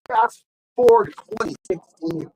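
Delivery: movie trailer narrator; dramatic announcement. Shown as a demonstration. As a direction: announce the time jump grandly. Dramatic jump in the story.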